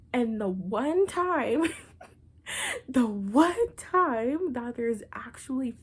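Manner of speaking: loud, slow laughing build-up; laughing disbelief. Real, not acted. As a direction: laugh through the setup in disbelief.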